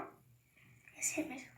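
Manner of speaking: whispered aside after hitting herself; quiet ow-that-hurt aside; hushed after bumping. Real, not acted. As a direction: mutter it under the breath, stung.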